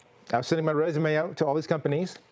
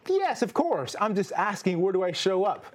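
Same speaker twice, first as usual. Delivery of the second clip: much higher, eager, with a laugh; eager pretend answer; 3 semitones higher than usual. Host imagines eagerly accepting the job, high and laughing. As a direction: fake the confident new hire. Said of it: "was said".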